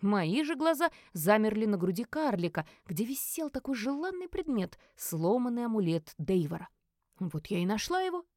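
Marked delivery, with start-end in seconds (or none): none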